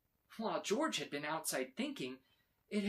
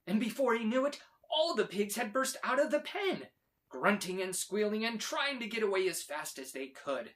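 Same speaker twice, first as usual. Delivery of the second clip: excited; 5 semitones higher, 5 dB louder than usual. A narrator's reading. Pigs burst out of pen.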